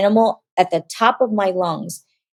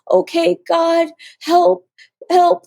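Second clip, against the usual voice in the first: tearful; 10 semitones higher, slower than usual. Real, not acted. Little sister praying over the phone, tearful. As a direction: pray high and simply, close to crying. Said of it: "It's gonna make me cry".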